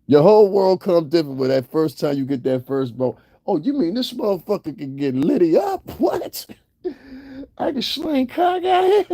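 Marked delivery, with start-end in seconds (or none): 3.5-9.1 high, incredulous squeal of discovery; boy amazed by his own discovery, hammed-up; mock astonishment. Voicing boy's astonished first discovery.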